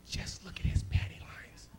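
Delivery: sly unvoiced whisper of the mind. Comic whispering the mind's sly voice in the jungle. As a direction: breathe it like a tempting voice.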